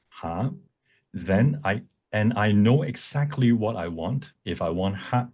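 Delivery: ordinary talk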